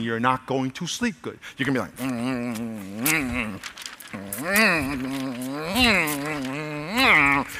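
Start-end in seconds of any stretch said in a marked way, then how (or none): 2.0-7.5 low, drawn-out grumbling moans; fretful closed-mouth groans; complaining hum. Acted. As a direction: moan and grumble wordlessly, as if sleepless in bed. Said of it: "go to bed complaining".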